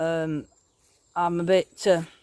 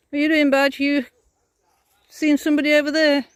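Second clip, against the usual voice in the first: talking to the dog; 8 semitones higher, 3 dB louder than usual. Real, not acted.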